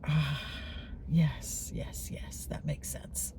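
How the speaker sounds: sigh, then drop into a whisper; whispered, relieved agreement as it dawns on you; hushed realization. Retold or imitated. Reacting to her friend's insight, hushed realization.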